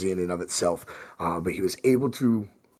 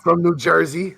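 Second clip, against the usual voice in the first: louder, higher hint of a New Jersey accent; 7 semitones higher, 6 dB louder than usual. Game master introduces the Nosferatu friend, New Jersey accent. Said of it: "he gotta talk like this".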